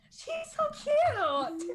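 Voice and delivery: high, slow, drawn-out gush over something adorable; gushing high and slow to the group. Acted. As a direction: coo it like you can't stand the cuteness. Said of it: "I'm gonna turn to the group and go".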